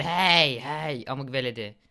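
a lightly voiced angry burglar; mock thug voice. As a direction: growl it out like the game's thug. Voicing the burglar in game.

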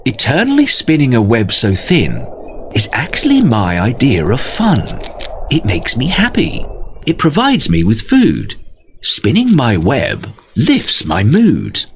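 spider voice. Acted. Spider explains her joy.

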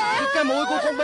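high, fast crying wail; panicked high wail; wailing in shock. Acted. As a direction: cry out high and fast in horror. Shocked at daughter's nose.

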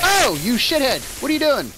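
high, loud outburst at an attacker; exasperated shout. Real, not acted. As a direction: snap loudly in real annoyance. Hit during a game fight.

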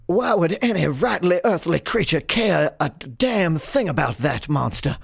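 high, theatrical showman's disbelief; protesting ringmaster. Acted. Ringmaster to the man seeking Bethany, high and incredulous. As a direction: play a bewildered circus boss protesting.

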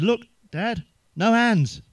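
put-on high, excited little boy calling out; a boy showing his dad his bike trick, high and excited; excited kid. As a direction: squeal it like a proud kid showing off.

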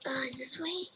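soft breathy whisper. Real, not acted. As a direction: whisper it under your breath. Quietly exploring the maze.

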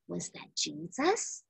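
ordinary delivery, nothing marked

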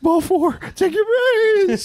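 very high, whiny mock-weepy umpire; weepy killer voice. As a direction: squeak it out like the weepy-voiced killer calling balls.

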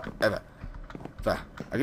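low, tense mumbling; hushed. Real, not acted. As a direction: hush it under your breath.

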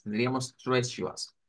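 normal talking voice

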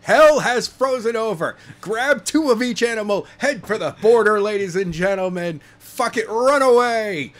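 loud, high hammed-up alarm; mock doomsday proclamation. Co-host reacting to shock wrestling news, mock doomsday proclamation. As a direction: cry it out like a mock town crier.

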